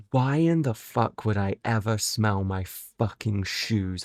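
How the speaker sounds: ordinary talk, nothing marked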